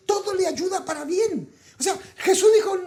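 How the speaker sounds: voz aguda que se quiebra en llanto; voz quebrada. Real, not acted. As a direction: conmovido hasta el llanto, sin dejar de predicar. Predicador se quiebra al hablar. (predicador que llora al hablar del amor de Dios)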